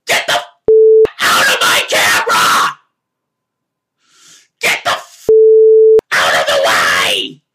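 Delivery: roaring, bleeped-off rage shout; furious yell. Pretend. Podcaster finally yelling at the man blocking his camera, furious. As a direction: scream him out of the way. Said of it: "I wanted to yell".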